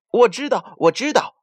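high, bright eager chirping; eager calling out. A narrator's reading. Myna bird blurting out a hiding spot, eager high call. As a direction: blurt it out like a know-it-all bird. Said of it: "一来到这儿就开始叫了".

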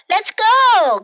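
high, loud cheer; cried out. Acted. The class cheering Miss Moon, loud and high. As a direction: shout it out eagerly. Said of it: "cried the class".

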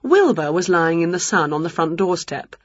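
ordinary delivery

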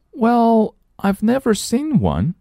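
put-on high child voice; doubtful little girl, high.

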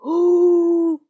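high, loud sustained hoot; scary ghostly howl. A narrator's reading. Frightening sound from the dark cave, ghostly howl. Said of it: "又传来了可怕的声音".

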